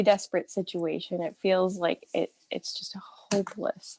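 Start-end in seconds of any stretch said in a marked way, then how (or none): 2.5-4.0 a voiceless, defeated whisper; hopeless whisper. Real, not acted. Describing her depression, whispering it's hopeless. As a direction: breathe it out as a hushed whisper.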